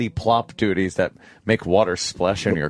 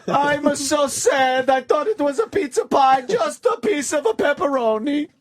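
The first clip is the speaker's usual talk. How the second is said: high wailing mock Italian accent; jolly italian pizza chef. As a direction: wail like a heartbroken Italian chef. Italian astronomer wails over pizza.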